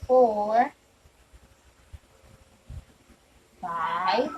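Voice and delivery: put-on long pauses between numbers for kids; slow counting. Shown as a demonstration. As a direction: count slowly and wait for the class. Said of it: "Can you count them with me".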